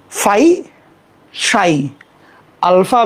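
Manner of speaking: ordinary talk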